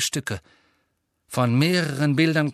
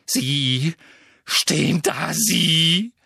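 louder, rising broken shouts; shouting; 5 semitones higher, 6 dB louder, much slower than usual. A narrator's reading. Harry shouts at Dumbledore, words failing him. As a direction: sputter with rage.

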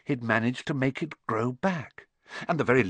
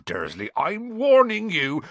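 rising warning; 7 semitones higher, 4 dB louder than usual. Acted. Hagrid threatens Vernon.

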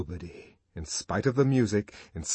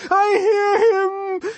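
very high, loud, drawn-out wail; ecstatic cry; 7 dB louder, much slower than usual. A narrator's reading. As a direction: cry out slowly in a rapturous high voice. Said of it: "she cried".

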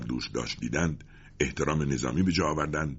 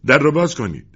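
loud, high shout; shouted order; 12 semitones higher, 11 dB louder than usual. A narrator's reading. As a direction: yell the command at the prison door. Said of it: "و فریاد زد". (فریاد دستوری)

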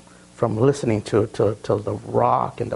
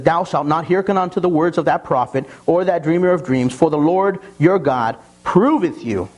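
scripture reading; 5 semitones higher than usual. Acted. Pastor reads Deuteronomy to the congregation, solemn. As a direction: read it out with weight.

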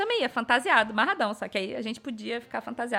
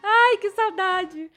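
nostalgic; 12 semitones higher, 6 dB louder, much slower than usual. Real, not acted. Remembering the 2012 event.

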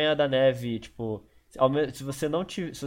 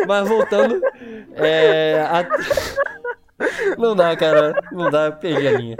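loud helpless laughter while talking; can't stop laughing, words breaking through; 6 semitones higher, 9 dB louder than usual. Real, not acted. Laughing fit after losing train of thought.

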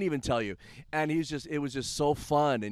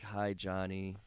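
sheepish; 5 semitones lower, 9 dB quieter, much slower than usual. Retold or imitated. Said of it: "sheepishly walking up".

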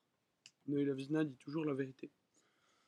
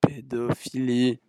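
buggy moderator bot; 9 dB louder than usual. Pretend. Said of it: "vous êtes bugué, monsieur le modérateur".

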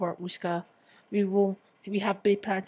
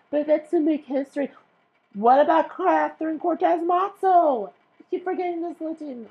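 excited; 8 semitones higher, 9 dB louder than usual. Real, not acted. Excited senate talk.

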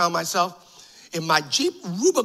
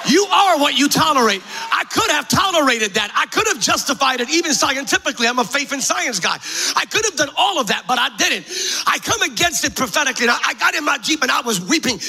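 impassioned preacher, driving the point home; forceful preaching; 7 semitones higher, 5 dB louder than usual. Real, not acted. Preacher tells the congregation what he refused to tolerate, impassioned. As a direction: preach it with rising urgency.

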